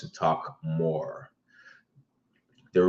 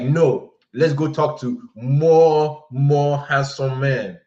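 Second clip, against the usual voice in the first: high, loud, slow insistence; insistent drunk girl; 6 semitones higher, 5 dB louder, much slower than usual. Retold or imitated. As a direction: drag it out stubbornly.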